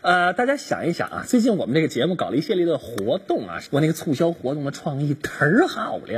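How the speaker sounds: cheery radio show host; radio host patter. Acted. Host Li Lin greeting her radio listeners, chatty announcer.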